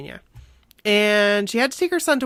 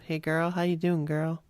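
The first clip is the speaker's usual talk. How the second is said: lower, soft crooning pop-star voice; 4 semitones lower, 6 dB quieter than usual. Retold or imitated. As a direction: croon it softly, a little lower, like a lightly voiced heartthrob. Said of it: "That's definitely not how he talks".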